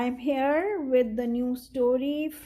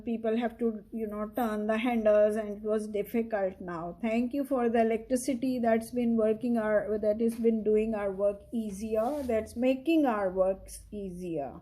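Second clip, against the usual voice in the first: plain reading voice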